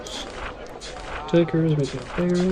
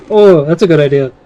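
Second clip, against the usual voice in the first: suddenly loud, eager burst; excited idea; 12 dB louder than usual. Real, not acted. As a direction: blurt it out, thrilled. Sudden idea while playing.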